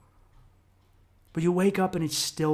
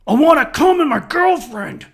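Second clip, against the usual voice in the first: loud, much higher primal growl of a voice; the lizard brain yelling its want; primal inner voice; 10 semitones higher, 10 dB louder than usual. Retold or imitated. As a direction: bark it like a raw animal urge. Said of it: "a primal voice in the back of my head".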